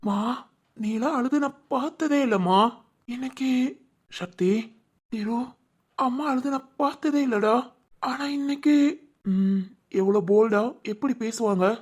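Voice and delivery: soft, hurt and close to tears; hushed sorrow. Acted. Child seeing a strong mother cry, hushed and sorrowful. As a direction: speak quietly, shaken with grief.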